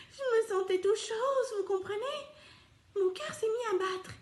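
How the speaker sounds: high, fluttery, overwhelmed delight; émoi ravi. Acted. Donkey overwhelmed by the kiss. (tout ému, voix aiguë)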